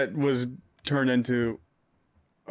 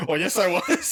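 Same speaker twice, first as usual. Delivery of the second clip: laughing; 6 semitones higher than usual. Real, not acted. Admits it amid shared laughter.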